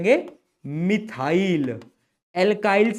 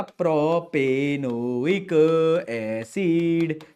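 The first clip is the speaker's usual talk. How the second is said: drawn-out name; 2 semitones lower, much slower than usual. Shown as a demonstration. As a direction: stretch propanoic acid very slowly, syllable by syllable. Teacher spelling out the name. (नाम को बहुत धीरे खींचकर बोलना)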